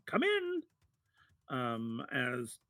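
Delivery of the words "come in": very high, tiny sing-out; little voice. Acted. Tinkerer calling to visitors at his small door, little high voice. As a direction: call from inside in a tiny high voice. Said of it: "this little voice inside going".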